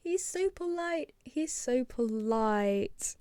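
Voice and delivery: drawn-out, high-pitched coo; slow cooing, stretching the words out; adoring gush. Real, not acted. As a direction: melt over how sweet he is. Gushing over a polite character.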